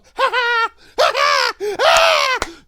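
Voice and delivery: big shouted burst of laughter; loud laugh. Real, not acted. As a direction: howl with laughter, high and loud. Cracks up at own joke.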